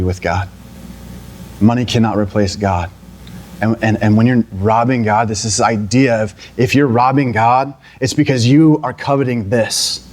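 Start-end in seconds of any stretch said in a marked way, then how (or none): none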